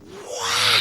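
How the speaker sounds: put-on high breathy wow of amazed children; a hushed, wondering kids' gasp. Kids in the audience react to the joke, high and airy. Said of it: "They went".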